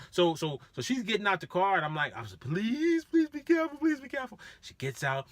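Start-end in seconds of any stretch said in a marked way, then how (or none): 2.4-4.4 pitched up, begging her to be careful; high pleading. Retold or imitated. Driver begging drunk rider to step carefully, high plea.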